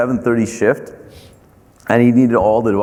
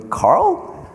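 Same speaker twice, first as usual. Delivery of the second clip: much higher, startled question; surprised. Retold or imitated. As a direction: call his name in sleepy disbelief. Customer surprised at night call.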